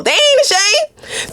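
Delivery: "ain't" stretched way up high and slow; high, slow and playful. Shown as a demonstration. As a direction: tease it high and drawn out. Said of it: "this person has no shame at all".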